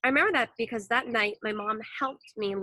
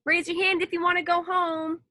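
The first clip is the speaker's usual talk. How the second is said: mock yell; 7 semitones higher than usual. A narrator's reading. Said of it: "I yelled".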